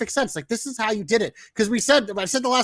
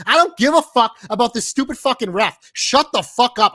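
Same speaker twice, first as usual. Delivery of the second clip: louder, exasperated outburst at the commentators; angry rant; 8 dB louder than usual. Retold or imitated. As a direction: yell it at the TV, fed up. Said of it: "I'm like".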